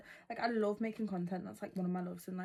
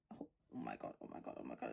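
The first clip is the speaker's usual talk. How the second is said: breathless hushed oh my God; panicked whisper; 11 dB quieter, much faster than usual. Real, not acted. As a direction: gasp it out low as it spills. Glitter spilling everywhere.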